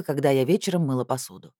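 plain reading voice

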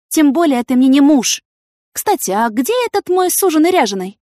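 high, loud scolding woman; indignant heroine. A narrator's reading. Yana rebuking the investigator for jealousy, indignant.

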